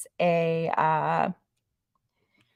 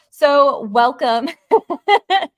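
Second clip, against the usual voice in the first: laughing welcome; 7 semitones higher, 8 dB louder, much slower than usual. Real, not acted. Laughs while opening the topic.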